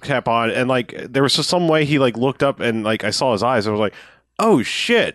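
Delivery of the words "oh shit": high-pitched retold shock; startled realization. Co-host retelling when he saw the actor's eyes, startled realization. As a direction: act out a sudden stunned recognition.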